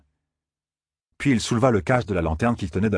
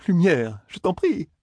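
desperate plea; 10 semitones higher, slower than usual. A narrator's reading. Matt shaking on his knees, begging the Light. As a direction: cry out for help.

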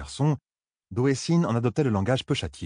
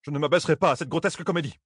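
fast, high-pitched haughty refusal; irritated refusal; 7 semitones higher, faster than usual. A narrator's reading. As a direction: refuse with indignant disdain. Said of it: "grogna Thalène". (refus hautain et agacé, débit rapide)

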